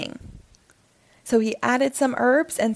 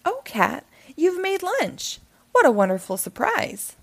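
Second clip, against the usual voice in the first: happy; 7 semitones higher than usual. A narrator's reading. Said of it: "she said happily".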